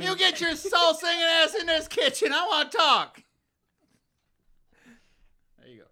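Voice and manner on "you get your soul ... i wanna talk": high, loud Southern wife yelling; deep South accent. Retold or imitated. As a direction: call him into the kitchen, Southern and loud. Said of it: "deep South accent".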